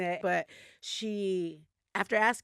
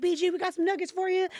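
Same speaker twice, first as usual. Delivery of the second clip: mock staff call-out; 10 semitones higher, faster than usual. Staff calling out to B G.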